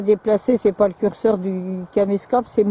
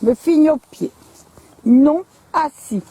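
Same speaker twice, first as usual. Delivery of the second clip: firm dog command; 6 semitones higher, 4 dB louder than usual. Real, not acted. Training dogs, giving commands.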